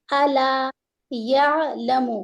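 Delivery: each letter stretched out; slow recitation. Shown as a demonstration. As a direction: sound out slowly for learners. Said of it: "یا فتحہ عین سکون".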